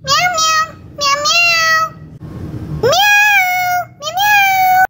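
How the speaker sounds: high-pitched pretend kitty meows; long, high kitty calls; cat meowing. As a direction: meow back in a high cat voice. Cat answers when called back.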